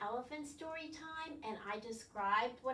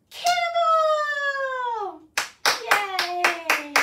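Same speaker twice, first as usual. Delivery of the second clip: much higher, loud, drawn-out cannonball cheer; excited shout; 11 semitones higher, 16 dB louder, much slower than usual. Acted. Teacher shouts the cannonball jump for the children, loud. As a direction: shout it like jumping in.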